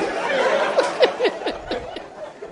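high wheezing laugh; helpless high-pitched laughter; long laugh running out of breath. Real, not acted. Laughing at own story.

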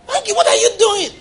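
high, startled question; shocked. Retold or imitated. Guest at breakfast shocked by the preacher's sugar, high and startled. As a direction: sound scandalized by the sugar.

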